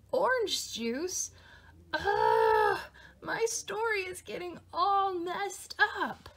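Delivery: fed up with the mess; exasperated. Acted. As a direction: Louie shouts over the spilled juice. Louie exasperated at juice.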